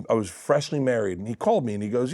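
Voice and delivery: ordinary talk, nothing marked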